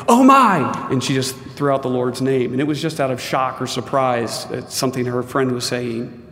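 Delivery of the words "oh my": sudden high, loud "Oh my!"; startled exclamation. Retold or imitated. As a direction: blurt it out as if startled. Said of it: "she exclaimed".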